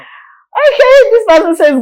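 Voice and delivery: surprised okay. Real, not acted. Host reacts to the next text.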